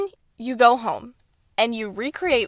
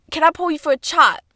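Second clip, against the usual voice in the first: mock British accent; 5 semitones higher, 3 dB louder than usual. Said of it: "And, like".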